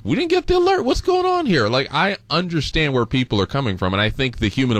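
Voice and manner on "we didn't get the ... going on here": high-pitched mock outcry; acted-out indignant complaint; indignant resident. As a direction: voice an upset local demanding answers, pitched way up. Voicing upset residents' complaint.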